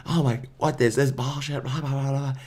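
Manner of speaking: the stranger stomping up the road, a mild mock yell. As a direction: rant to himself in a mock huff. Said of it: "yelling at himself".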